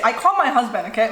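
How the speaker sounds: usual speaking voice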